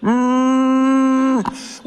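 cat's angry yowl, hammed up; angry cat meow. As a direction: yowl it like a furious cat down the chimney. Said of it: "Like this".

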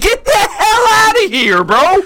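high-pitched laughing outrage; outraged laughing shout. Real, not acted. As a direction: yell it in laughing disbelief. Outraged protest between friends.

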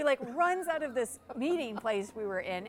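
ordinary talk

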